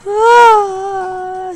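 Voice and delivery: high, loud drawn-out scream of fright; terrified scream. Real, not acted. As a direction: scream in real terror. Scream at jump scare.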